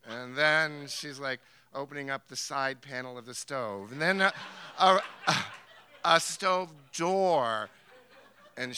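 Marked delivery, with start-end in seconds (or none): none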